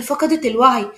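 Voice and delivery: ordinary delivery, nothing marked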